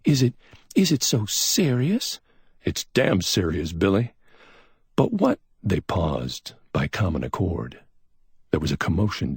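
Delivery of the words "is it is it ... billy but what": lightly voiced young woman, raised higher; a hint of a worried young woman, pitched up; Billy's voice. A narrator's reading.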